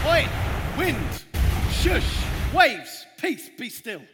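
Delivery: loud, high, firm command; commanding shout. Acted. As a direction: order the storm to stop. Jesus commands the storm.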